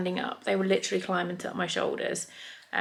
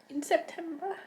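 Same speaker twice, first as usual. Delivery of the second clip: real grief, halting between phrases; very emotional. Real, not acted. Owner telling viewers about losing Bob Bob, very emotional. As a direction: hold back tears, pausing to steady yourself. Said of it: "where I was very, very emotional".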